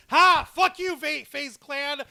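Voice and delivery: loud, high triumphant jeer; exultant taunt; gloating shout. Real, not acted. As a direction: crow over a beaten rival. Gloating at losing esports team.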